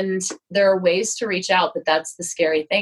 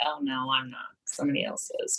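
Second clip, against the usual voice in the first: hushed, shrinking whisper; meek deflection; 5 semitones lower, 11 dB quieter than usual. Retold or imitated. Brushing off an artist's compliment, in a small whisper. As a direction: shrink away from the praise, almost no voice.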